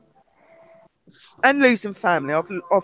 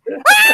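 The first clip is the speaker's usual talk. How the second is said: shrieking laugh; 7 dB louder than usual. Real, not acted. Laughing while telling the fall.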